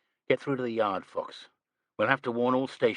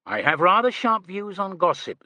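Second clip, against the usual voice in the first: dry; 10 semitones higher, 6 dB louder than usual. A narrator's reading. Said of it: "said Troy dryly".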